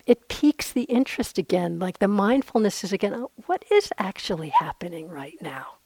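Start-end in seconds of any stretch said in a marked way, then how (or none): 3.1-5.8 hushed, softer curious whisper; whispered curiosity. Retold or imitated. Teacher voicing mindful curiosity to herself, whispered. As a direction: let it come out as a gentle whisper. Mindful inner question voiced aloud.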